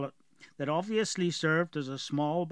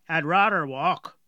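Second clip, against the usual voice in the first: slow, thick southern fisherman's dialect; gruff dwarf with a heavy rural accent; strong dwarf accent; 2 semitones higher, 7 dB louder than usual. Acted. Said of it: "Galoric had a very strong accent".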